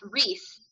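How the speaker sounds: "Reese", rhyming with fleece; "Reese" pronunciation. Shown as a demonstration. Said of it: "it's pronounced".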